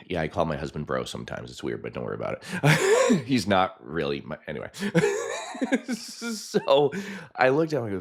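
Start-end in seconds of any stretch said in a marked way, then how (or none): 4.7-5.9 high-pitched giggle. Real, not acted. High laugh.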